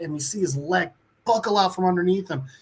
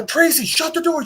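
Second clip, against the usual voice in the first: urgent order; 11 semitones higher than usual. Retold or imitated. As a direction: yell it so she obeys right now. Father orders wife from outside.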